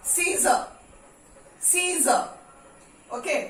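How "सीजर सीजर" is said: सीजर said siza, no c; silent c. Shown as a demonstration. Teacher modelling silent-c सीजर for students. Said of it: "सी का कोई मतलब ही नहीं".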